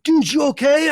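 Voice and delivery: imitated friend's high, loud yell; re-enacted shout of concern; calling down. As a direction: call down to someone fallen far below.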